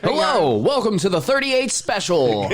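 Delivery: high, slow nerdy announcer. Pretend. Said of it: "my nerdy announcer voice though".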